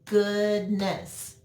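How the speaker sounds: "Goodness" split into two beats, good and ness; "Goodness" broken slowly as good, ness; clapped out syllables. Shown as a demonstration. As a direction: clap out "Goodness", separating the syllables evenly. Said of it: "Clap it out".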